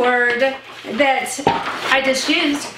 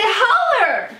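higher, drawn-out Texan call; calling out holler; 7 semitones higher than usual. Retold or imitated. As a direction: sing it out like calling someone to dinner. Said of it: "You just say".

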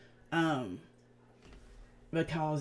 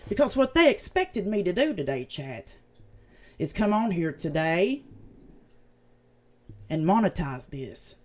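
usual speaking voice

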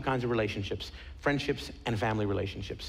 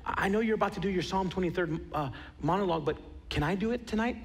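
put-on higher-pitched, polite boy's voice; higher voice, rising into a polite request; young boy; 7 semitones higher than usual. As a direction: ask like a hesitant thirteen-year-old boy. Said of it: "it's this thirteen-year-old little boy".

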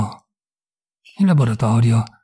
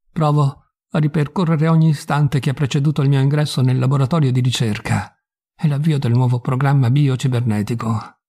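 plain reading voice

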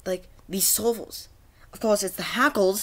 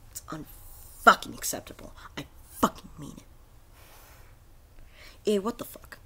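seething whisper. Real, not acted. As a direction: whisper it through rage, barely holding it in. Seething at broken game.

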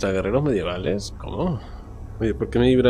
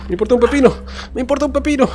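high, loud frantic shouting; frantic yelling; 12 semitones higher, 6 dB louder, much faster than usual. Real, not acted. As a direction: yell it out frantically.

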